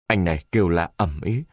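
ordinary delivery

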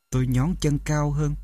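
plain reading voice

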